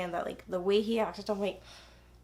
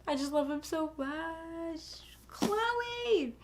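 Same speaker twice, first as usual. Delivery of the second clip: gushing squeal; 8 semitones higher, 4 dB quieter, much slower than usual. Real, not acted. Gushing over favourite book couple.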